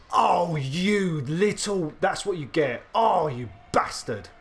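furious outburst. Real, not acted. Gamer raging at the enemy pilot, loud angry snarl. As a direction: lay into the player who got you.

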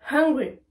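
Hungry with a strong breathed h; Hungry, h clearly sounded. Shown as a demonstration. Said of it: "je respire".

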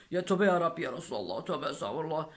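low, muttered plea for forgiveness; mock pious dismay. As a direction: mutter it like a shocked old believer. Disgusted by the burning smell.

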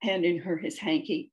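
ordinary delivery, nothing marked

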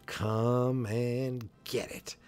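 slow, drawn-out challenge; taunting hero. Acted. Superman crouching to meet the car, taunting. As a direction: stretch each word as a mock-heroic taunt.

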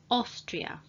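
Austria, starting with an "O" sound. Shown as a demonstration. Teacher models Austria for learners, AU said as "O". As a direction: open Austria on a clear "O". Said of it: "A and U together at the beginning".

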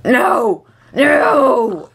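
high, drawn-out wail of dismay; mock-anguished cry. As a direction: cry out as if all is lost. Not enough material for pants.

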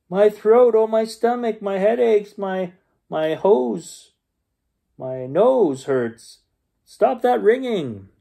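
high, hammed-up moaning patients; complaining patients. A narrator's reading. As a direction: wail each ailment like a fussy patient. Crowd of patients all complaining.

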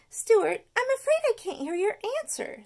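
high, loud schoolteacher voice; teacher voice. Acted. Miss Finnegan quizzing the geography class, high and loud. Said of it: "said Miss Finnegan".

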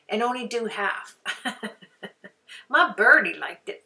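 usual speaking voice

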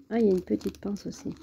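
usual speaking voice

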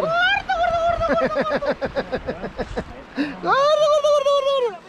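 high squeaky gobbling voice; turkey gobble. Retold or imitated. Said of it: "¿Cómo dijo el pavo".

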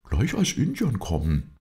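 high, lively character voice; Bob, eager helper. A narrator's reading. Bob tells his master how he found the tree, high and lively.

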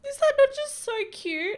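high, loud squeal of delight; gushing squeal. Real, not acted. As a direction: squeal over how cute it is. Gushing over Sims wedding dance.

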